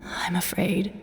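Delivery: soft, breathy whisper. Acted. Dying elder admits to his friend, whispered. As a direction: let it fall to a whisper.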